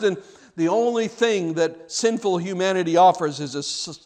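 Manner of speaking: ordinary talk, nothing marked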